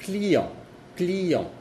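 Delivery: client with a nasal end; French client, nasal; French demo word. Shown as a demonstration. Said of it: "Por ejemplo".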